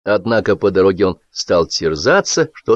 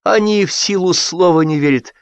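getting worked up; 11 semitones higher than usual. A narrator's reading. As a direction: rant as he warms to it. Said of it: "разошёлся Сапфир".

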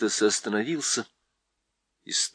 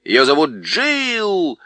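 slow, louder shout to a deaf ear; loud owl call; 4 semitones higher, 6 dB louder, much slower than usual. A narrator's reading. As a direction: call it out slowly so he hears. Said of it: "громко крикнула сова".

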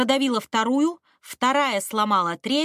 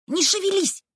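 sharp high order to freeze; commanding; 10 semitones higher, 4 dB louder than usual. A narrator's reading. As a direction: order him not to move. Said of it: "приказала Рыча".

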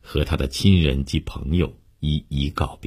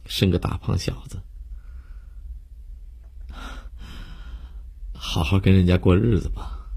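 composed farewell after crying, slight lift in pitch; 咽下抽泣; 2 semitones higher, 5 dB quieter than usual. A narrator's reading. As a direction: sobs swallowed, rhythm forced back to steady. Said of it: "悠悠咽下抽泣，". (强忍抽泣，恢复平稳的告别)

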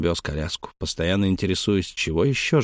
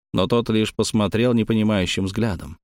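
usual speaking voice

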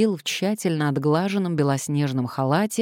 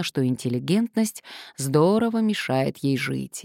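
ordinary delivery, nothing marked

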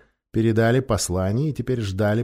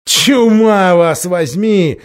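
loud, high, slow curse; furious cry; 8 semitones higher, 10 dB louder, much slower than usual. A narrator's reading. Miraz cursing his lords for their advice, furious shouted curse. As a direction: explode in fury. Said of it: "вскричал Мирас".

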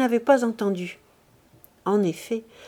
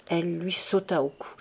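plain reading voice

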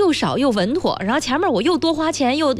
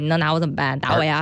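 shameless rogue; 8 semitones lower than usual. Pretend. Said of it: "这就是臭无赖的态度".

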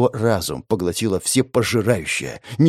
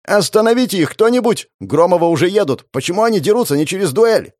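higher-pitched call for help; alarmed onlookers; 6 semitones higher than usual. Acted. Onlooker begging someone to stop the fight, alarmed. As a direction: voice an agitated bystander crying out.

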